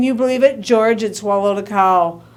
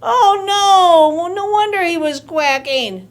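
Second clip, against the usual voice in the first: higher, louder "oh no" of shock; dismayed; 5 semitones higher, 4 dB louder than usual. A narrator's reading. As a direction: exclaim in mock horror. Reaction to the duck.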